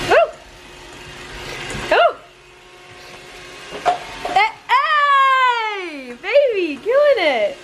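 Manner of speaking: excited cheer. Real, not acted. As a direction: cheer her on. Cheering a successful shot.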